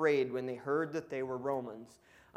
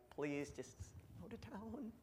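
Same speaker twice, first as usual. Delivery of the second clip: much softer, nervous begging; timid pleading; 5 semitones higher, 12 dB quieter than usual. Acted. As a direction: officials meekly urging them to leave. Frightened officials begging Paul.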